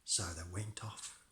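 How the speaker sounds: plain reading voice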